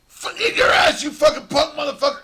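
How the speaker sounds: mock taunting yell. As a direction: bark it at the game pieces like a psycho. Psycho taunting his Risk pieces.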